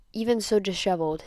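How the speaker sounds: ordinary delivery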